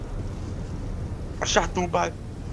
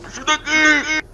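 desperate raised plea; man down shout; 4 semitones higher than usual. Real, not acted. Downed, begging teammates for help.

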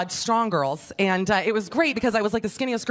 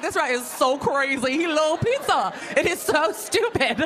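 laughing; 5 semitones higher, slower than usual. Retold or imitated. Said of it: "I was like".

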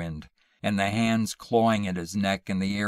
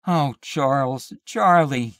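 higher, louder pained apology; remorseful cry; 6 semitones higher, 8 dB louder than usual. A narrator's reading. Wife to the husband she frightened, remorseful. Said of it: "all remorse".

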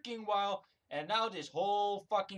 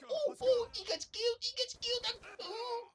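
very high squeaky mock voice; mocking buddy impression; 3 dB quieter than usual. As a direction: mimic the opponent's buddy in a silly falsetto. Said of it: "And then his buddy go".

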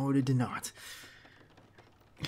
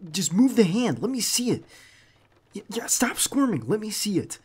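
raised, higher urgent voice, pleading with the injured partner; louder and higher, frantic to see the wound; 9 semitones higher, 9 dB louder than usual. Acted. Checking the wounded partner's hand.

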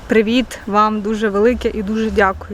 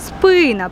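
СПИ-на, higher stretched И falling away; stress on first syllable; 4 semitones higher than usual. Shown as a demonstration. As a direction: lean hard on the И in спина. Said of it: "Перший склад". (наголос на перший склад: спИна)

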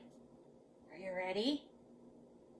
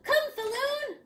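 high, loud heroic cry; calling back; 13 semitones higher, 8 dB louder than usual. A narrator's reading. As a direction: shout it boldly on the way out. Boy calls back while riding off.

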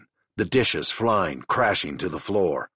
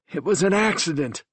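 higher, strained pleading; desperate plea; 12 semitones higher than usual. A narrator's reading. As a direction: beg to be believed. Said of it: "Kyle said at last".

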